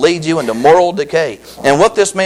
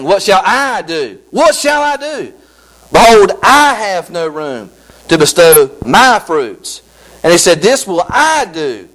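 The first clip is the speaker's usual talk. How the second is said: slow, solemn King James reading; scripture reading; slower than usual. Acted. Preacher reading the rich man's thoughts from the Bible, slow and solemn. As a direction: read the rich fool's words slowly and gravely.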